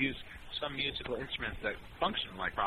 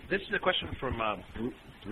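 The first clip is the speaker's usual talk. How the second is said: ordinary talk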